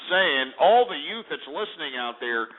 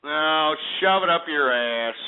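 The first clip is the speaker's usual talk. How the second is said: ordinary talk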